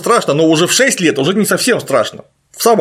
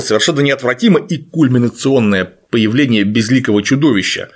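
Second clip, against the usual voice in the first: ordinary talk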